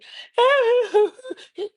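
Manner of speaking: high, loud mock crying; imitated wail. Shown as a demonstration. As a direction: wail wordlessly like a hurt parent. Imitates a parent's wordless cry.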